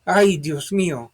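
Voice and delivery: frightened high, loud cry; scream. Acted. Sergeant guarding the door screams in fright. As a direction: yell it out in sudden fright. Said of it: "Martinez screamed".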